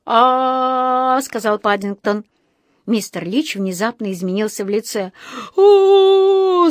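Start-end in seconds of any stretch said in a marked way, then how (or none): 0.1-1.2 long "aah", higher than speech; obedient "aah". A narrator's reading. Patient opening wide for the dentist, drawn-out "aah". Пациент тянет «а». 5.6-6.7 long, high, loud "oooh"; pained wail. A narrator's reading. Dentist bitten by his patient, wailing loudly. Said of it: "завопил он".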